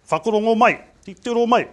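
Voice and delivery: loud, high called command "Whakarongo mai"; kapahaka call. Retold or imitated. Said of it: "what they used to say to us is".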